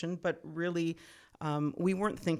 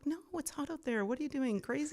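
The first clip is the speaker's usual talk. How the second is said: high-pitched incredulous scolding; mock scolding; 10 semitones higher than usual. Locals telling off tourists for walking in the heat, mock scolding. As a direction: scold them in mock disbelief.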